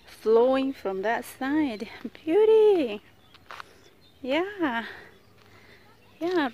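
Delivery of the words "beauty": ordinary talk, nothing marked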